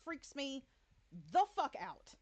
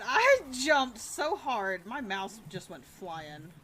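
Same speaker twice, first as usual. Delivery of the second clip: slow shaken; 3 semitones lower, 4 dB louder, slower than usual. Real, not acted. Describing a jump scare.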